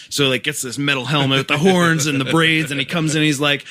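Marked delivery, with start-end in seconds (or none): none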